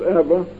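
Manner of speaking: "Eva" as EV-a, short eh; Eva rhyming with Emma. Shown as a demonstration. Preacher explaining a twin's name, "Eva" as EV-a. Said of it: "to rhyme with her twin sister".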